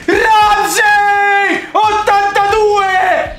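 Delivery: high, loud goal scream; goal celebration shout. Real, not acted. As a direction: scream the goal at full force. Goal call, live reaction.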